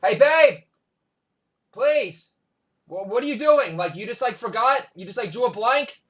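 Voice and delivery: high, loud, fed-up shout at the wife; exasperated yelling. Real, not acted. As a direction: holler at her for barging into your recording. Yelling at wife during podcast.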